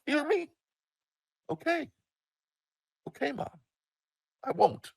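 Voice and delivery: very high, small voice; cowed exchange. Retold or imitated. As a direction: imitate a tense mother and a meek kid, high-pitched. Mother demands, cowed kid agrees.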